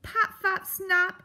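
high, loud, blustering grump; crying out. Acted. Grump garbles the mouse's name, crying out. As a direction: fumble the name at full testy volume. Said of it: "Aerith cried".

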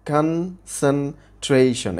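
Concentration said very slowly in four separate syllables; broken into syllables. Shown as a demonstration. Teacher showing students four syllables, concentration slowly split syllable by syllable. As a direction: split concentration as kan, sen, trei, shon, with gaps. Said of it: "ভাগ করে উচ্চারণ করতে হবে".

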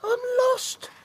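tiny high lost chick; cheeping chick. Acted. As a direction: squeak it, a little scared. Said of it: "cheeped the little chick".

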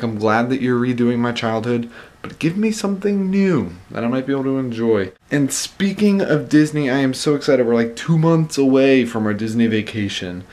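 usual speaking voice

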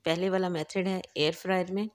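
ordinary delivery

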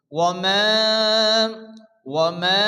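মা held very long on one tone; ওয়া মা with a long sustained final aa; four-alif stretch. Shown as a demonstration. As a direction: stretch the vowel of মা for four beats. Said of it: "চার আলিফ পরিমাণ টান দিয়ে".

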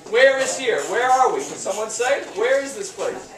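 ordinary talk

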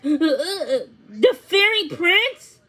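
halting question, then high loud cry; slow, incredulous outburst; stunned disbelief. A narrator's reading. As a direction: stammer in shock, pitch climbing. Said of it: "could barely speak".

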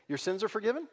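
Your sins are forgiven tossed off with a doubtful upward lilt; sceptical rising question. Shown as a demonstration. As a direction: say it like an easy cheap claim. Mocking how easy to say.

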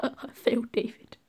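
hammed-up sorrowful whisper; mock whisper of guilt. Mock-grief apology to teammate.

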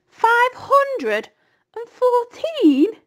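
high, loud incredulous squeal; flabbergasted young pig's cry; astonished disbelief. A narrator's reading. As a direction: stretch it out in amazed disbelief, voice shooting up. Wilbur amazed at the number.

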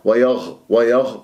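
"ويغ" ending in an airy kh; ghayn turned kha. Shown as a demonstration. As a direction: let breath rasp on the ghayn of "ويغ". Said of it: "حتى لا أشممها خاء".

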